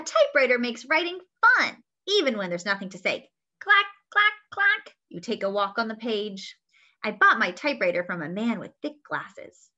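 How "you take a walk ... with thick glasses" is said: usual speaking voice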